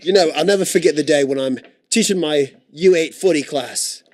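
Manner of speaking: mock British footy coach; put-on British accent. Footy correspondent recalls teaching his class, mock British accent. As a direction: reminisce in a hammed-up British accent.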